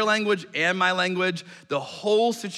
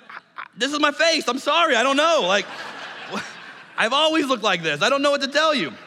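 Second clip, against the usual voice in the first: high, loud flustered protest; panicked pleading; 8 semitones higher, 4 dB louder than usual. Retold or imitated. Pleading with the shouting old woman, high and panicked. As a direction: stammer out a desperate apology, much higher.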